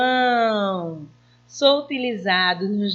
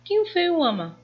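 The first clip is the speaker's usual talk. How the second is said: much higher, playful repeat; teasing question; 8 semitones higher than usual. Shown as a demonstration. Teacher playfully repeating the question to the class. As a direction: ask it again, way up high. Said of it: "Eu disse quem ele amava?".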